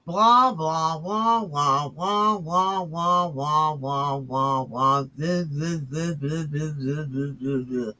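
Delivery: sing-song wah-wah gibberish, steadily fading; mocking drone. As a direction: drone it repetitively to drown out the speaker, trailing down. Mocking the played clip as droning nonsense.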